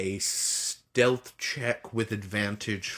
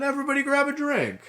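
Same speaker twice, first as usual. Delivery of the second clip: high, fast party call; host announcement; much faster than usual. Acted. GM calls on everyone at the party, high and fast. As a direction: rattle off the host's call.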